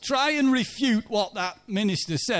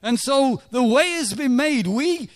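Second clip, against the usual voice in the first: rising into full preaching, higher and louder; building sermon; 5 semitones higher, 4 dB louder than usual. Acted. A preacher urging the congregation, climbing to full force. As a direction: press the point home to the congregation.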